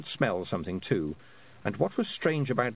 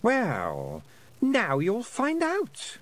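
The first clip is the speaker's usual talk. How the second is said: sweet menacing queen; 11 semitones higher, much slower than usual. A narrator's reading. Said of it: "smiling sweetly".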